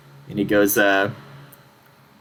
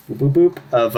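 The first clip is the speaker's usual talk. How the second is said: kenku noise; 3 semitones higher than usual. Acted. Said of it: "you hear the noise".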